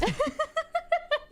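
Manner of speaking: high delighted laugh. Real, not acted. Laughing at Toast's loss.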